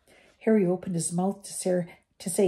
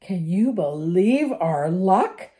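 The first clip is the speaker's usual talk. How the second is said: miserable; 5 dB louder, slower than usual. Acted. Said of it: "Ron said miserably".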